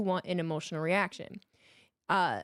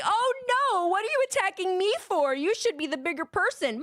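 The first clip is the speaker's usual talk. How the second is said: mock whiny victim; 11 semitones higher than usual. Mocking a bully playing the victim, whiny. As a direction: whine like a wounded bully. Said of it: "Then suddenly".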